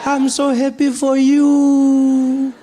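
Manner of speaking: high, drawn-out grandmother impression; delighted granny voice. Grandmother congratulating her grandson on his job, high slow delighted voice. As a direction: coo each word slowly, way up high. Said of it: "And she's like".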